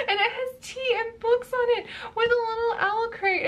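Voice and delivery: high, gushing excitement; bubbly high-pitched delight. Real, not acted. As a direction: rush it out, thrilled by every detail. Delighted unboxing item.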